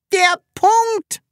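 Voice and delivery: very high, loud exclamation; piercingly high cry of discovery. A narrator's reading. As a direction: shout it in sudden realisation. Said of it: "rief es".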